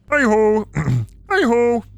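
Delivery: high puppet-frog voice; hunting for the frog's pitch; Kermit. Acted. Streamer testing a Kermit greeting, high frog impression. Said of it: "No, Kermit".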